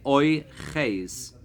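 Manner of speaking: Oy Ches said slowly, syllable by syllable; teacher's slow model of Oy Ches; pronunciation demo. Shown as a demonstration. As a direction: spell out Oy Ches carefully for the class. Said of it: "if you wanna say it in the present tense".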